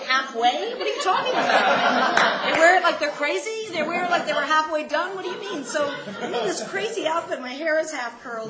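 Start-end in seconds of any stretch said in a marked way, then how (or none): 0.0-5.6 high, loud disbelieving questions; loud mock indignation; incredulous retort. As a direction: throw it back in raised-pitch disbelief.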